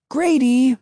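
high, insistent call after someone. A narrator's reading. Emily calls after her departing brother.

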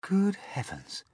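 slow, high whisper; breath-only amazement; astonished gasp. A narrator's reading. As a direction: gasp it slowly in shock. James gasps at the sight.

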